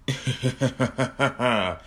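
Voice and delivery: creepy laughing; villain laugh. Acted. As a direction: laugh over the dead victim. Plushie laughs after kill.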